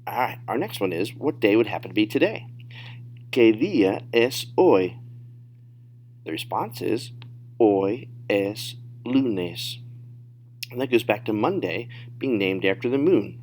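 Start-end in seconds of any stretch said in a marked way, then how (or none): 3.3-5.0 slow, louder model question; deliberate teacher demo; slow, careful model. Shown as a demonstration. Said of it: "say them at natural speed". 7.6-9.8 very slow, words pulled apart; drawn-out teacher demo; slow, careful model. Shown as a demonstration. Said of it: "say them at natural speed".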